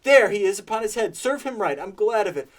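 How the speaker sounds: high, loud, gleeful storybook rant; between laughing and crying. A narrator's reading. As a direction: relish every figure with giddy glee. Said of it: "in a most extraordinary voice, between laughing and crying".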